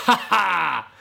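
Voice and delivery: loud gloating ha ha; mocking laugh. Fox laughing at the old man, loud. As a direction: cackle as he runs off.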